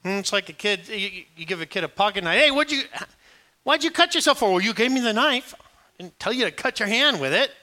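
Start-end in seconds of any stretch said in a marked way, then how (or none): 2.4-4.5 high, louder scolding; scolding parent. Retold or imitated. Parent scolding a kid who cut himself, high and loud. 4.5-7.5 put-on higher, louder childish excuse; kid's excuse. Kid blaming the parent for the knife, higher.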